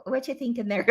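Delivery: normal talking voice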